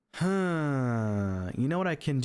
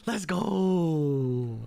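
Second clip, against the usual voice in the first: normal talking voice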